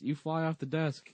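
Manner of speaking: put-on high child-actress line; feed the story like a small kid; imitating little girl. Quotes Michelle's story line.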